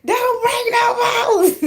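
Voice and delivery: high, slow, drawn-out yell; hollering a warning across the slippery floor; screaming warning. Retold or imitated. Parent recalling yelling at kids during a water balloon fight. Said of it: "I was screaming".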